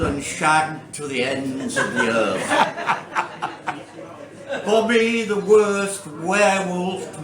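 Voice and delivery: deliberate recital, relishing each word in the list; comic poetry reading. Acted. Poet telling an audience about the neighbours' cats, comic recital. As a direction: read comic verse aloud with spaced-out phrases. Reads comic poem aloud.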